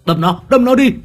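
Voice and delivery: high, loud urgent yell; urgent shout. Acted. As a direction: bark the order to stab. Accomplice urging stab.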